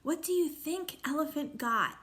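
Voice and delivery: plain reading voice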